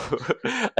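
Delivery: short laugh jumping well up in pitch; embarrassed giggle; high burst of laughter. Real, not acted. Laughing off a touchy question to the host.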